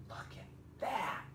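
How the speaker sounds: breathy, hushed whisper of wonder; awed whisper. Real, not acted. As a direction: whisper it as if afraid to break the spell. Awed aside during story.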